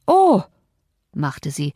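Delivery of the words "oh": high, loud, indignant exclamation; high gasp of indignation; speechless outrage. A narrator's reading. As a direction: burst out, too outraged to say more. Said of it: "zu empört, um weitersprechen zu können".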